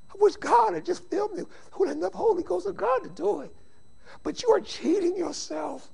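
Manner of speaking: high, choked-up preacher's plea; tearful pleading. Real, not acted. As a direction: plead with them as if close to real tears. Preacher overcome, pleading with congregation.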